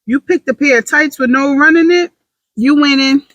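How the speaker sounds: high, hyped-up self-praise; celebrating herself. Acted. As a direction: cheer yourself on like a girlfriend. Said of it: "That is how low I celebrate myself".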